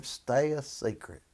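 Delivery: ordinary talk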